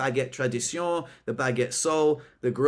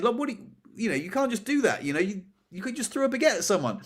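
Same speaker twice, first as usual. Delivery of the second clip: telling someone off; 7 semitones higher, faster than usual. Retold or imitated. Boss lecturing the baguette thrower, mock telling-off. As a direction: imitate a boss's indignant lecture, pitched up. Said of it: "had a massive go at him".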